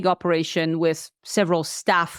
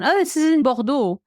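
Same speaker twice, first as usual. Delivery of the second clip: ordinary talk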